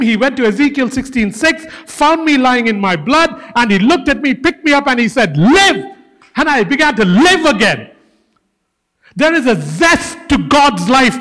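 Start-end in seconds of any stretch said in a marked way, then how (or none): 5.4-6.0 commanding shout. Retold or imitated. God commands the dying one.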